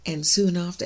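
ordinary delivery

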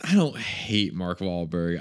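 usual speaking voice

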